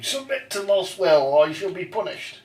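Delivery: high, loud proclamation, drawn out; mock villainous command. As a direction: proclaim it like a hammy dominated zealot. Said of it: "basically, I went around trying to basically say".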